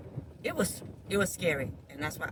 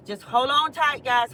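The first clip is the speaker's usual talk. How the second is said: calling out; 5 semitones higher, 7 dB louder than usual. Real, not acted. Calling out to viewers.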